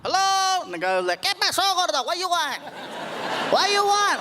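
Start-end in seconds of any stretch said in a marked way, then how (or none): 0.0-0.6 high, drawn-out "hello"; calling out. Acted. Timid customer calling at taco truck. 1.2-4.2 high, pushy counter man; mean taco guy. Acted. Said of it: "the guys were mean".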